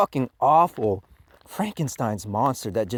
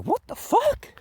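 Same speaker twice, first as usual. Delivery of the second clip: retold disbelief. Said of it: "I was like".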